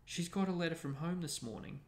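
ordinary delivery, nothing marked

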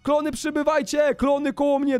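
high, urgent rallying cry; hyped play-commander call; summoning call. Pretend. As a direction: call the troops to you excitedly. Summoning clones.

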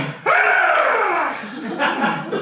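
roaring laugh. Acted.

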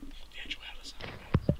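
hushed, spooked whisper. Real, not acted. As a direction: breathe it out in a whisper. Spotting something strange underground.

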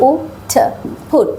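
ফুট, meaning put, said with an ʊ vowel; sounding out. Shown as a demonstration. Teacher sounding out put for students. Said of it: "কিন্তু এটা ফি".

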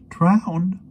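very high, loud, scoffing question; astonished one-word retort; incredulous exclamation. A narrator's reading. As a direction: shoot the pitch up in disbelief. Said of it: "the voice in her blood exclaimed".

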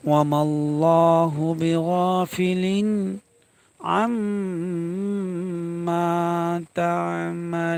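drawn-out chanted recitation with held vowels; Quran recitation. Shown as a demonstration. Teacher chanting the verse for students, melodic recitation.

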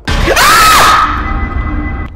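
very high scream; terrified scream. Real, not acted. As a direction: scream in terror. Screaming at jump scare.

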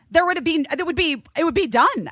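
ordinary delivery

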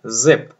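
ज़िप said clearly as zip with short i; model pronunciation. Shown as a demonstration. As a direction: say ज़िप crisply, one short vowel. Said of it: "pronounce करिए". (साफ़ उच्चारण में ज़िप)

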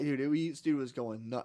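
ordinary delivery

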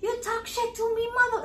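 playful Irish-accent old-man impression. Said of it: "he had this thick an-, accent".

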